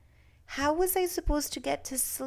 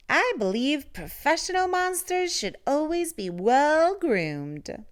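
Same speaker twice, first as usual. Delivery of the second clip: higher, louder and silky, a refined monster; 7 semitones higher, 3 dB louder than usual. A narrator's reading. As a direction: sound like a polite, groomed monster. Ralph speaks.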